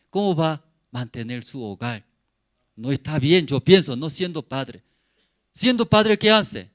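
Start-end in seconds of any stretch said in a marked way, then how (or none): none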